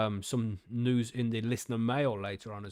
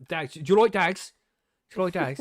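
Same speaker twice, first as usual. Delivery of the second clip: put-on Irish Traveller accent from Snatch; Brad Pitt pikey accent; 5 semitones higher, 6 dB louder than usual. Podcaster quoting Snatch to co-hosts, pikey accent. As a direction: quote the film in a pikey accent. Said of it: "Brad Pitt as the pikey".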